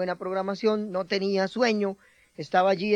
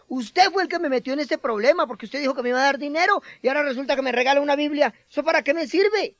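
agudo, fuerte y rápido, culpando a gritos; furioso reclamo; 6 semitones higher, 3 dB louder than usual. Acted. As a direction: descarga su rabia contra el padre desde la cama. Hijo herido culpa al padre. (reclamo furioso, agudo y rápido)

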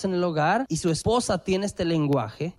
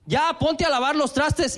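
higher, slow scolding order; bossy demand; 6 semitones higher than usual. Shown as a demonstration. Wife orders husband to wash dishes, bossy demand. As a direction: snap out the order like a nag. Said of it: "A eso se refiere pedir, no reclamar".

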